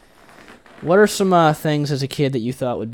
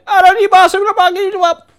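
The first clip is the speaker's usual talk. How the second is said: very high, loud mock-Arabic tirade; hammed-up shrieked gibberish in fake Arabic; mock Arabic yelling; 15 dB louder than usual. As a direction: imitate an intense foreign yell, pitched way up. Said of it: "yelled at me in Arabic".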